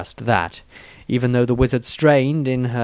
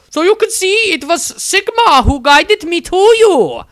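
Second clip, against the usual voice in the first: high, loud, syrupy nun voice; a much higher, louder fervent sister; zealous nun; 7 dB louder than usual. A narrator's reading. Nun Angelique sweetly insists to the wizard, high and loud.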